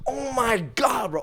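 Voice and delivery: high, stretched-out exasperated gamer; mock raging. Roommate raging over his video game, high drawn-out exasperation. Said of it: "Or, like, he's raging".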